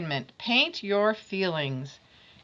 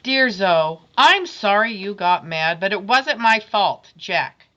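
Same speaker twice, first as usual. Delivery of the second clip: put-on loud, defensive kid voice; defensive boy; 8 dB louder than usual. Jack defending himself to Zoe, loud and stubborn. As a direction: insist it wasn't your fault. Jack's second note.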